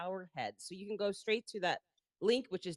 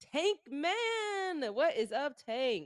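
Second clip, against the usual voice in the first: hype intro call; 7 semitones higher, much slower than usual. Acted. Hype intro calling out guest.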